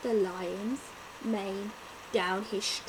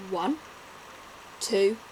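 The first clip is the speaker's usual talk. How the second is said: ordinary delivery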